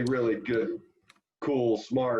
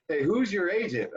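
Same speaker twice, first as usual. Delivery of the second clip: put-on higher, eager old manager's sales pitch; Tommy Lasorda impression; 9 semitones higher than usual. Lasorda cold-calling Kevin about Japan money, high hearty pitch. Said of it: "he goes".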